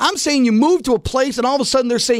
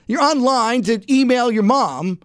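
ordinary delivery

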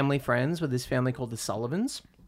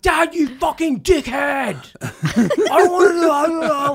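imitated tantrum yell; 14 semitones higher, 9 dB louder than usual. Friend screaming at his dad, high, loud yell. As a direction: scream it like a bratty kid. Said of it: "He'd be like".

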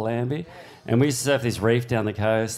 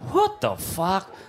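slow, drawn-out, higher disbelief; stunned disbelief; 7 semitones higher, slower than usual. Retold or imitated. Crowd watching him surf ten foot, slow stunned disbelief. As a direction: let the shock stretch the words. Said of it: "everyone's just like".

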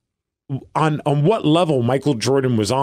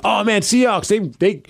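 retold optimism; 7 semitones higher than usual. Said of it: "I was like".

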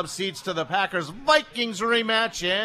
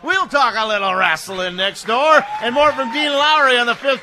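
loud, upbeat break tease; radio host tease; 4 semitones higher, 7 dB louder than usual. Acted.

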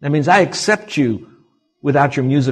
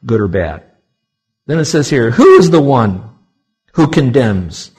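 louder, rising preacher's proclamation; full-force preaching; 5 dB louder than usual. Acted. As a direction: proclaim it to the whole congregation.

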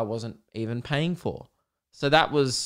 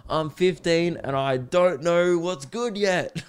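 put-on slow, higher dopey teen voice; mocking a fifteen-year-old; 5 semitones higher, slower than usual. Podcaster mocking a clueless fifteen-year-old, in a slow dopey voice. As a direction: play a clueless young teen. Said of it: "It's like".

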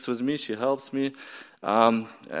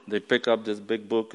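ordinary delivery

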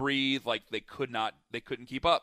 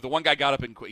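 normal talking voice

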